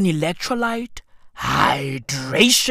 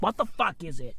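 ordinary talk

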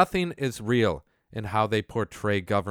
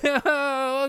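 high scornful laugh; 14 semitones higher than usual. Real, not acted. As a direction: laugh them off. Laughs at quoted believers.

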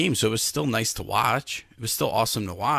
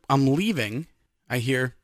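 usual speaking voice

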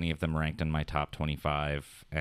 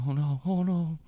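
high, hammed-up oh no; mock oh no; 11 semitones higher, 3 dB louder than usual. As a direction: replay the panicked oh no, high. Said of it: "I don't know why I just, like, was like".

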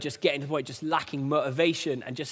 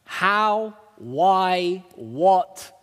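exasperated disbelief; 3 semitones higher, 4 dB louder, much slower than usual. Retold or imitated. Baffled reply to Paul's advice.

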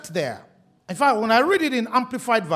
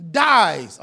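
dies hit louder and lower; stress on dies; 5 semitones lower, 6 dB louder than usual. Shown as a demonstration. Preacher underlining scripture, "dies," punched loud. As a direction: lean hard on "dies,". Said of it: "underline dies".

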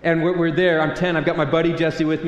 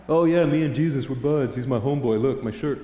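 casual about Jesus. Retold or imitated. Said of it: "how relaxed our culture is on God".

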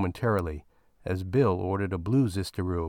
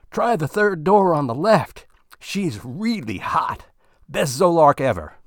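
much higher, louder, leering recommendation; Bill pointing Walt to the best room upstairs; eager tip; 7 semitones higher, 7 dB louder than usual. Acted. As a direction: pass on the tip with a leer.

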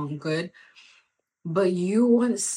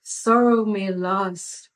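loud slow preaching; 6 dB louder, slower than usual. Real, not acted. Preaching build.

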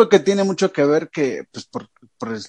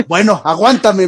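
policeman agreeing; 5 semitones higher, 6 dB louder than usual. Retold or imitated. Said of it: "me dice".

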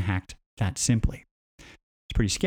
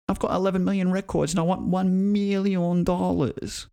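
mock kid voice; 6 semitones higher than usual. Kid voiced in imagined scene.

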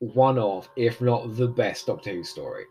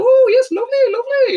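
high, loud fake Welsh washerwoman; bad Welsh accent; 9 dB louder than usual. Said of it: "with this dreadful Welsh accent".

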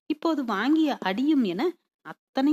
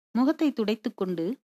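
plain reading voice

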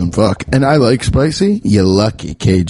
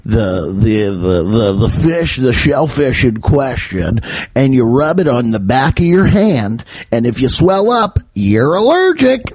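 Google. Acted. Search engine explains an allergy test in a hammed-up put-on voice. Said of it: "this is how Google talks".

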